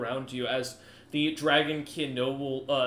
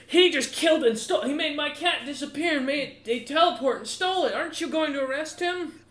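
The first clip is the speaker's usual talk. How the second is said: high-pitched noble pleading his case loudly; indignant noble; 12 semitones higher, 5 dB louder than usual. Acted. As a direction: complain to the guard captain in outraged high voice. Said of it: "He's gonna look over at the guard captain, the kobold, and go".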